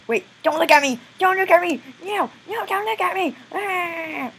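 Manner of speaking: high, squealing plea; mock embarrassed panic. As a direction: plead in hammed-up distress. Endoskeleton begs not to be seen.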